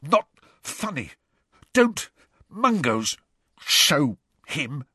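high, strained words in broken bursts; choked spluttering. A narrator's reading. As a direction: splutter odd words as if being throttled. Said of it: "odd words spluttered from his mouth".

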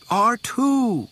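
high, loud, delighted cry; excited exclamation. A narrator's reading. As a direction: cry out in happy surprise. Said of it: "he exclaimed".

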